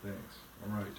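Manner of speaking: plain reading voice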